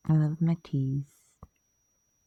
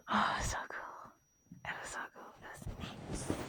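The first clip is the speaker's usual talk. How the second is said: barely audible breathy whisper; soft awed whisper; dreamy whisper; 16 dB quieter than usual. Real, not acted. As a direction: whisper it blissfully. Dreamy recollection of show.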